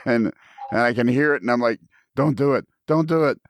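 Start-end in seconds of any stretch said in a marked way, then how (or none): 2.1-3.4 pleading inner voice, pitched up; mock plea from the voice in his head. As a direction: plead with yourself not to give in. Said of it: "I hear my other voice is saying".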